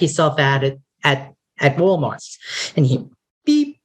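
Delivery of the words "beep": high imitated beep; scanner beep. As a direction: chirp it like a scanner. Self-checkout scanner beep.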